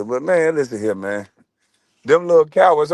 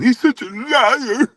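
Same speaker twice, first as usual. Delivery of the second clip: high, drawn-out tearful whine; hammed-up wounded falsetto, very slow; mock a man crying in a girlish voice; mock-feminine impression; 11 semitones higher, slower than usual. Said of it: "sounding feminine than a mug".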